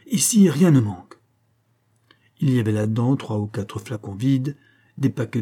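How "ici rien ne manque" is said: louder, higher boast falling away; a touch of crowing pride; triumphant. A narrator's reading. Director showing off the first-aid box, triumphant. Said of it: "triompha Verdier".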